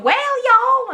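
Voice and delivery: high, loud caricature Southern US twang; mock Southern accent. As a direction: holler a cartoon Southern American accent. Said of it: "I can only think of one".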